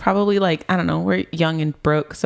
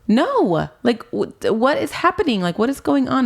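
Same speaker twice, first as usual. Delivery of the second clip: retold panicked question; 7 semitones higher than usual. Panicked retold reply on the phone.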